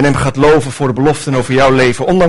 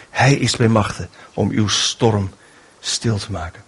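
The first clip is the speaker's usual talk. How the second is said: hushed awe; 7 semitones lower, 7 dB quieter than usual. Acted. Preacher telling his church Jesus calms storms, hushed. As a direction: draw them in quietly to the power of Jesus.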